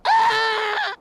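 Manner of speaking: piercing high loud scream; child's scream. Acted. Mildred screaming at the mouse, piercing shriek. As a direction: shriek like a terrified little girl. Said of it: "began to scream".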